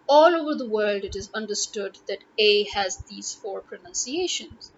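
ordinary talk, nothing marked

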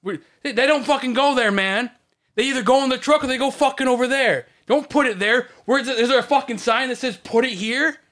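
angry rant. Shown as a demonstration. Scolding a coworker about where skids go, angry and loud. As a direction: tear into him, fed up.

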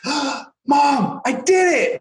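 a son shouting his win to his mom, high and joyful; imitating a thrilled kid. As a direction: burst out like a four-year-old who just did it. Child zips jacket, jubilant.

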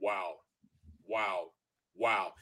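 low, soft, drawn-out wows, head shaking; a coach quietly stunned watching film; coach's stunned wow. Retold or imitated. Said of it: "He's going like this".

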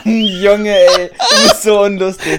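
slow, laughing complaint; can't stop laughing. Real, not acted. Lacht über Witz des Freundes.

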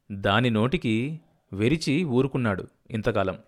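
ordinary delivery

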